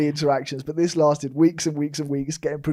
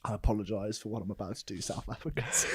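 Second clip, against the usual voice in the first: normal talking voice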